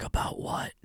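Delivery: voiceless, very soft mock reply; whispered reply. Answering the therapist in a voiceless whisper. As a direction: breathe it out with no voice. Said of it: "And I was like".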